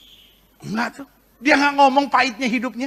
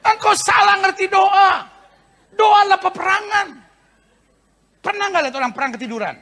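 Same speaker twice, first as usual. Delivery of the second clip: forceful rebuke; 7 semitones higher than usual. Acted. Preacher correcting the churchgoer, shouting. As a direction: declare it like a battle cry.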